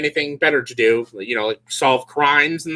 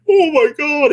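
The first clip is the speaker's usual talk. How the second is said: high, louder startled exclamation; mock shock; 12 semitones higher, 5 dB louder, slower than usual. Woman noticing a creep looking at her, mock shocked. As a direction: exclaim in exaggerated alarm.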